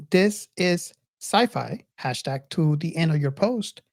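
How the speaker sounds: ordinary talk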